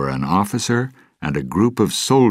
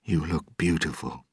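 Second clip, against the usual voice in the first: softer, tender compliment; softly; 6 dB quieter than usual. Acted. Will to his bride Elizabeth, soft. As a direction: say it gently to her. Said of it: "he added softly".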